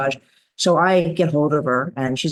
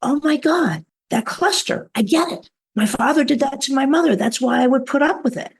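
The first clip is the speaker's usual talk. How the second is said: high, bright epiphany voice; the sudden realization, higher throughout; excited revelation; 10 semitones higher than usual. Retold or imitated. Camper realizing her pattern, in a high excited voice. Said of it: "And she's like".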